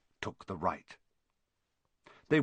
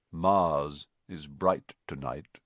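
slow, low, mournful musing; deep, sorrowful; 7 semitones lower, slower than usual. A narrator's reading. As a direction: muse on the sky slowly and low. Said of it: "He had a deep, sorrowful voice".

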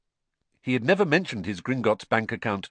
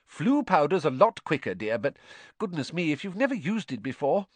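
higher fussing mother voice; higher worried mum; Mrs Weasley, fretting; 7 semitones higher than usual. A narrator's reading. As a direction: fret kindly over Harry.

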